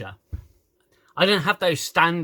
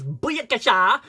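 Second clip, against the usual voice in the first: loud, high mock captain's call; captain's catchphrase; 8 semitones higher, 5 dB louder, slower than usual. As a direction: belt it out as a hammed-up catchphrase. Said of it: "This captain says".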